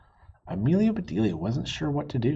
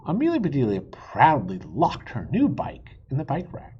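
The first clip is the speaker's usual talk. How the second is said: ordinary delivery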